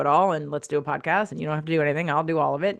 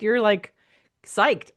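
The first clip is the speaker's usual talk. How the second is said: ordinary delivery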